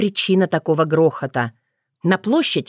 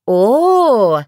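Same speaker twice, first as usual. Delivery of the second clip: drawn-out, higher, louder "oh"; pleased drawl; 5 semitones higher, 5 dB louder than usual. A narrator's reading. Girl hears her cat coming, pleased drawl. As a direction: stretch it out with satisfaction. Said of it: "довольно протянула она".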